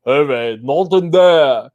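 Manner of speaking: slow, drawn-out imitation voice; hammed-up impression, stretched and loud at the end; Andre. Doing a viewer's requested voice, slow hammed-up impression. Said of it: "in best Andre voice".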